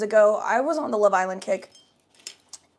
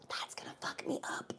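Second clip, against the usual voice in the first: breathy whispered aside; whispered That's gonna fuck me up; 14 dB quieter than usual. Shown as a demonstration. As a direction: whisper it to yourself.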